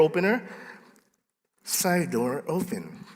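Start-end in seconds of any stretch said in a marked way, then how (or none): 1.6-3.1 slow, soft automated announcement; be the alarm system's voice, slowly and softly; Telus alarm lady. Retold or imitated. Pastor imitating the office alarm lady, slow robotic announcement. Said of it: "the Telus lady says".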